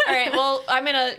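loud laugh into the words. Real, not acted. Laughing at the GM's remark.

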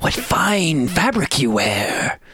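slow, laughing admiration; admiring. Acted. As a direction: admire it slowly through a laugh. GM voices wizard admiring.

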